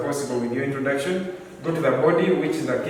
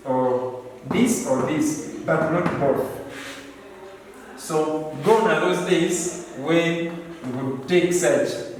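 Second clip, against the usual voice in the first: normal talking voice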